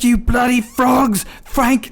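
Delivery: high, slow English-lad jeering with a laugh; English hooligan jeer. Retold or imitated. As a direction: drag out the insult like a rowdy fan. Hooligan insulting the French.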